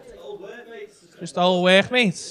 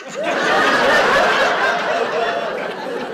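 squealing breathy laugh; high laugh; 4 dB louder than usual. Real, not acted. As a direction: burst into laughter. Laughing at the joke.

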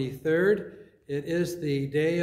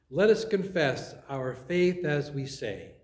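ordinary talk, nothing marked

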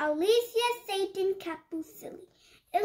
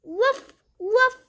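high little woof woof of a puppy; puppy bark; 4 semitones higher, 7 dB louder than usual. Acted. The puppy Biscuit barking back, high happy woofs. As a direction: yip it like a happy small dog.

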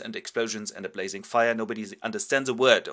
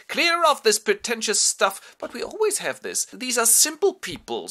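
bossy Biedermann; 13 semitones higher, 7 dB louder than usual. Acted. Biedermann orders maid to clear.

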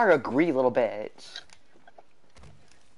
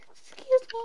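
squeaky high. Real, not acted. After sneeze, squeaky high.

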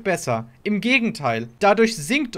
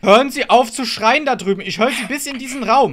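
laut und höher, scharf zurechtweisen; wütender Ruf; 5 semitones higher, 7 dB louder than usual. Real, not acted. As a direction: bring sie endlich zum Schweigen. Streamer schreit laute Mitspieler an. (Supporter brüllt den schreienden Spieler wütend an)

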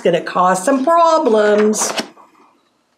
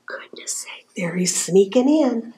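hushed, voiceless storyteller aside; very soft confidential whisper; 6 dB quieter than usual. Pretend. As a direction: whisper it as if spotting someone sneaking.

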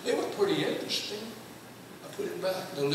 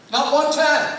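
louder and higher, hitting "one" hard; emphatic rebuke; 7 semitones higher, 9 dB louder than usual. Retold or imitated. Preacher scolding the class for never naming Jesus, emphatic. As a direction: drive it home.

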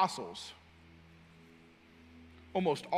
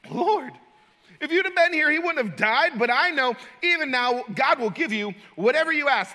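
raised, louder reproach to the Lord; anger mixed with faith; 9 semitones higher, 8 dB louder than usual. Acted. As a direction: accuse him, still believing. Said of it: "How much faith and anger is contained".

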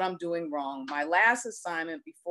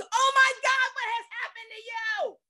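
horrified scream. Retold or imitated. Chaplain recoiling at the injured man, horrified scream. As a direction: shriek it like you just saw something gruesome. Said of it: "scream at the top of my lungs".

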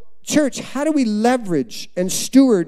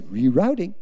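softer, lower navigation prompt; gentle GPS voice; 4 semitones lower, 3 dB quieter than usual. Pretend. Preacher demonstrating the GPS's voice to the crowd, gentle. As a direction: imitate a calm GPS, softly and lower. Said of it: "it's sort of a gentle voice".

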